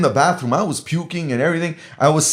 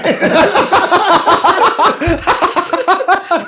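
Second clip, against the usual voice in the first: long, high burst of laughter; real high laughter; high laughing fit; 12 semitones higher, 3 dB louder than usual. Real, not acted. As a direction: laugh helplessly at the memory. Laughing at own story.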